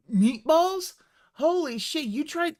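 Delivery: high, loud disbelief; can't believe what he just heard; incredulous. Real, not acted. Reacting to lean meatballs idea.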